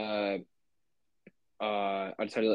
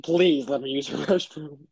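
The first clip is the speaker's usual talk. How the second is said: higher, playful begging; mock pleading; 4 semitones higher, 4 dB louder than usual. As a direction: beg in jest, breaking into a laugh. Imagined pleading with frat brothers.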